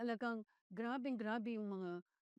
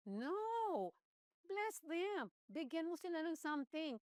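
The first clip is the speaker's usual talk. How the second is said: emphatic correction; 6 semitones higher, slower than usual. Real, not acted. Correcting the wrong prayer.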